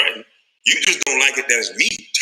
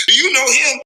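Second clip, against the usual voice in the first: high, louder mimicked question; nosy acquaintance; 8 semitones higher, 5 dB louder than usual. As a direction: pipe up as someone pestering him. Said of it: "how many times I hear that".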